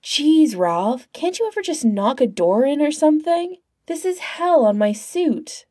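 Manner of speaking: high-pitched, peeved complaint; exasperated cynical agent. A narrator's reading. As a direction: gripe at a clumsy partner. Narrator voices exasperated agent.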